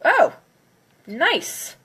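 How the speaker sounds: normal talking voice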